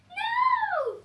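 very high, loud held scream of No!. Acted. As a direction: scream it in horror. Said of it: "Screamed the veggies".

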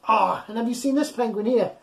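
plain reading voice

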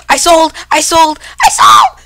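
high, loud cries climbing to a shriek; frantic shouting. Real, not acted. Blunders in the fight.

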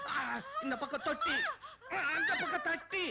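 high, laughing panic cries; a man caught in comic panic, squealing high; frantic high cries. Acted. Baby stuck, frantic cries.

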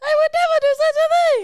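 loud, very high, rapid protest; hammed-up shrieking innocence; mock outraged denial. Accused of listening first, shrieking mock denial.

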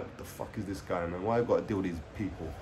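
low, soft mock inner grumble; doctor's silent exasperation. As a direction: voice a doctor's weary thought under the breath. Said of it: "he just looked at me like".

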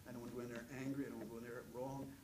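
soft, hushed, earnest plea to God; murmured prayer on his knees; softly, humbly asking God for help; quiet prayer. Retold or imitated. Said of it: "pray and say".

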